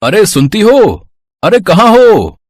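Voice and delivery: high, drawn-out call to his wife. Acted. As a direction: holler through the house for her. Husband calls out for his wife. (पत्नी को पुकारते हुए)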